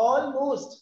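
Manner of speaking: "almost" split as all, most; two syllables. Shown as a demonstration. Teacher showing a class two syllables, "almost" split in two. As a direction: sound out "almost" in two distinct beats. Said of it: "there is a two syllable".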